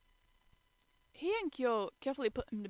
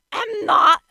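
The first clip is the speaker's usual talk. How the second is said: high, very loud shriek; 12 semitones higher, 20 dB louder than usual. A narrator's reading. Kyo denying he blushes, loud shriek. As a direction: shriek the denial. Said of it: "Kyo shrieked".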